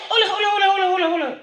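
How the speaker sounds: high, laughing through the hold ons. Real, not acted. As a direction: crack up while telling yourself to wait. Laughing while stopping himself.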